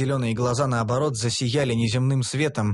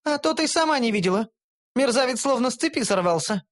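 higher, defensive elf voice; elf justifying herself; 9 semitones higher than usual. A narrator's reading. Elf defending herself to gnome, defensive. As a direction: make excuses defensively. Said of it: "принялась оправдываться эльфийка".

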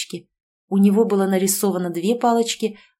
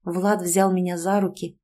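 ordinary delivery, nothing marked

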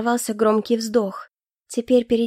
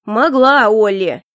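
louder sharp retort; raised voice; 7 dB louder, slower than usual. A narrator's reading. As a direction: raise your voice on it. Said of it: "Он тоже повысил голос".

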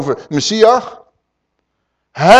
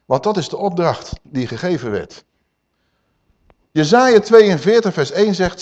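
ordinary delivery

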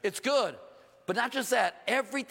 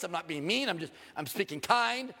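normal talking voice

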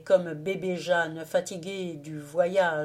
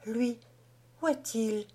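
higher, mildly worried question; lightly voiced anxiety, pitched up; anxious murmur; 4 semitones higher than usual. A narrator's reading. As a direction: ask with a little anguish. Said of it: "murmura Jeanne avec un peu d'angoisse".

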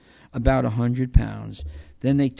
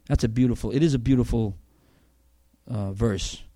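usual speaking voice

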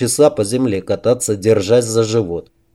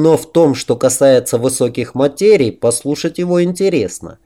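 ordinary delivery

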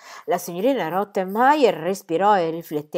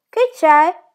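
maid calling down; 11 semitones higher, 10 dB louder than usual. Acted. Maid calls down from stairs.

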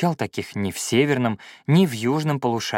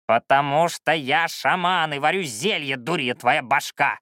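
raised, creaky, irritated voice; cranky old shaman; 3 semitones higher than usual. Acted. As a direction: fire back at a cheeky pupil. Old shaman snaps back at pupil.

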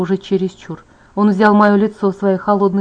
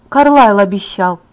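usual speaking voice

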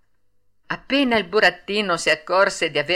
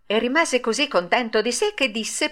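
plain reading voice

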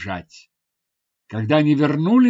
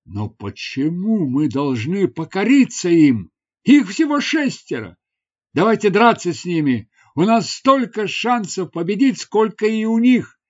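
higher, mildly heated rallying voice; rousing appeal; 5 semitones higher, 5 dB louder than usual. Acted. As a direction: urge reluctant fighters into battle. Hero urges warriors to fight. (пылкий призыв)

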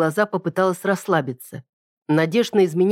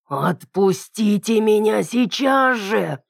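slow strained growl, pitched up; dragon growl; 5 semitones higher, much slower than usual. A narrator's reading. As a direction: force out a growl through a dragon tongue. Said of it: "с трудом прорычала я".

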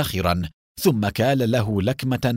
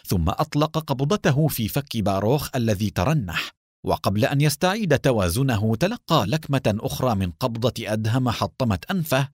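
plain reading voice

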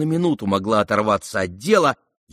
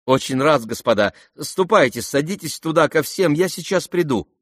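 higher-pitched, brisk hospitality; flustered politeness; 6 semitones higher, 3 dB louder than usual. A narrator's reading. The prince sends guests to join everyone, brightly flustered. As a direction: usher them away with raised, eager voice.

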